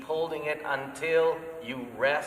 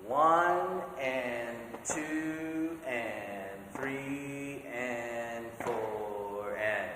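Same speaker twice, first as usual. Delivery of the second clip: counting drawn out very slowly, partly sung; slow sung-spoken counting beat by beat; much slower than usual. Shown as a demonstration. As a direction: stretch every count out slowly. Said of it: "I'm gonna really slow it down".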